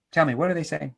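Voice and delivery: ordinary talk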